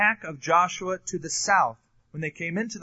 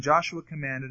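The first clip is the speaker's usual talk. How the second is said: normal talking voice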